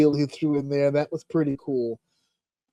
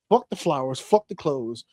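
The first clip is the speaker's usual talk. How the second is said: ordinary delivery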